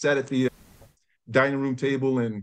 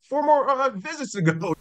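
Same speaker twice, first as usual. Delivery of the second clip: startled laughing reply; 9 semitones higher than usual. Retold or imitated. Retorting to mom, laughing.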